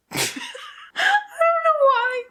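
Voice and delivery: helpless high-pitched giggle through the words; laughing. Real, not acted. Tipsy vlogger cracking up at a product name, laughing.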